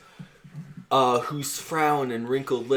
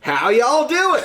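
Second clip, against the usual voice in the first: mock traveler; 12 semitones higher, 7 dB louder, slower than usual. Friend heckles the recital as the chummy traveler, loud and laughing. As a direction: butt in as a folksy traveler.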